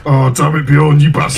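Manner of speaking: loud hammed-up curse at a donor; mock outrage. Streamer loudly cursing a donor in mock outrage. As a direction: bellow the betrayal in mock fury.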